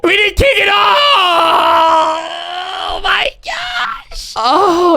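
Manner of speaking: very high, drawn-out slow wail; despairing cry. Real, not acted. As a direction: moan in total disbelief. Game simmed without his kick.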